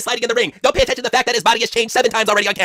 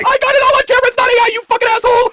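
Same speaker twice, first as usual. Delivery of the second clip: mock host yell; 5 dB louder than usual. Host yells at liar.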